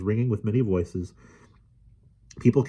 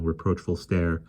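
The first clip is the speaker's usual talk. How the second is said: plain reading voice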